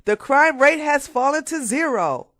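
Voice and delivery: loud, high mock congratulation; sarcastic praise. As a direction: praise them with a sneer.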